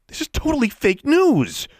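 high, loud indignant cry; loud outburst. Acted. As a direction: exclaim it loudly, pitch shooting up. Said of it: "she said loudly".

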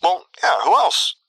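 high, slightly puzzled answer; Luke. A narrator's reading. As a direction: answer as if it were obvious, puzzled. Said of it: "sounding slightly confused".

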